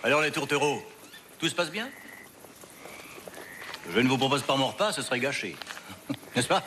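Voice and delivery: sneering captor taunting prisoners; mocking. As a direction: gloat over them with a sneer.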